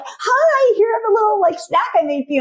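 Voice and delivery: very high sing-song greeting; overeager parent. Retold or imitated. Parent greeting teen home from school, high sugary cheer.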